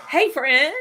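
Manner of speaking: high, loud mock-chummy hail; eager greeting. Visitor greeting the man with a spare ticket, eager hail. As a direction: pounce on a new best friend.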